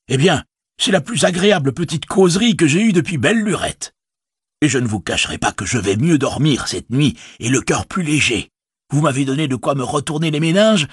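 a worried village innkeeper speaking up; innkeeper Butterbur. Acted.

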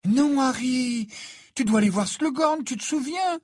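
high, loud, urgent protest; alarmed reminder. A narrator's reading. Hermione reminds Harry of his task, alarmed. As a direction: plead with him to remember. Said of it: "lui rappela Hermione".

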